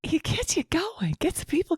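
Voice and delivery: high breathy whisper, slowed; mock conspiratorial whisper; teasing whisper. Real, not acted. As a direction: whisper it to tease her.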